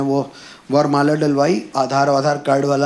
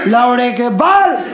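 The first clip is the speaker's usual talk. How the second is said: higher, slow, stretched-out curse; raised-voice insult; 6 semitones higher, 4 dB louder, slower than usual. Retold or imitated. Comic retelling the rider's abuse, slow raised voice. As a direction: call out the insult with drawn-out words. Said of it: "उधर से आदमी कहे".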